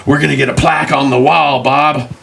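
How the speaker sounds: high-pitched mock excitement; proud eager watchman. Watchman to Bob dreaming of a plaque, excited. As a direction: crow it to your partner, hammed up.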